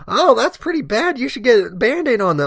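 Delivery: high-pitched mock old lady; meddling old woman. Old woman commenting on the firefighter's cut, high mock advice.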